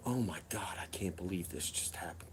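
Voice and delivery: very soft, whispered disbelief; stunned whisper. Retold or imitated. Front passenger watching the arrest, stunned whisper. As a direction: whisper it to yourself, imitating shock.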